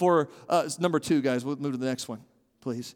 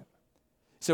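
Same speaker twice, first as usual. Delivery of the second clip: normal talking voice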